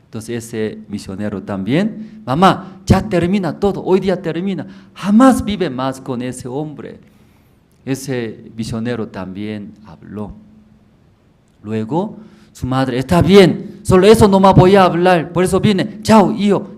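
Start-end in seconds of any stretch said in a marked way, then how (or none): 2.2-7.0 pleading son. Acted. 13.0-16.8 loud, high farewell from the mother; mother's goodbye. Acted. Mother cutting off her son with a loud goodbye.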